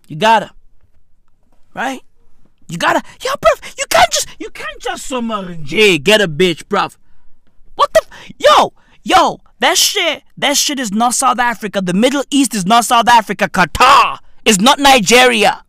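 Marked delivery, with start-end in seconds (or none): 2.7-5.1 exasperated. Real, not acted. Exasperated outburst at the audience. 7.7-15.6 loud, fast indignant rant; indignant shout. Real, not acted.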